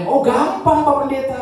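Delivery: put-on high, sweet old grandma voice; grandma's voice. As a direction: play the cheerful old oma. (suara nenek tinggi dan riang)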